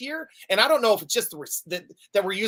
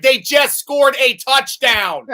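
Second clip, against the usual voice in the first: slow, loud, higher telling the crowd what happened; loud exasperated explaining; 5 semitones higher, 8 dB louder, much slower than usual. Retold or imitated. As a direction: spell it out loudly for confused fans. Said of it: "I was like".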